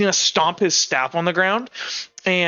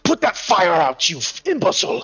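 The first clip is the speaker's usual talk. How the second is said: put-on pompous old boss; 2 semitones higher than usual. Boss orders henchman to douse the fire, pompous outburst. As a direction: berate an underling like a pompous old master. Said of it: "pompous old voice someone going".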